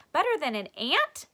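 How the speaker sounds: high, loud eager question. Acted. Stink boasting to class, eager. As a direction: pipe up with a challenge.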